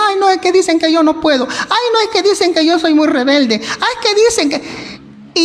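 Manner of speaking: high whiny voice, mimicking self-pity; whiny complainer. Pastor mimics a defeated person, high whine. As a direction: whine like someone who gives up. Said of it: "Hay gente que se conforma".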